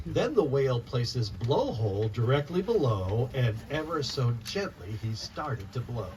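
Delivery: lively rhyming storybook read-aloud; reading to children. Acted. Author reading her picture book to children, lively read-aloud. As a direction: bounce the rhymes for a room of kids.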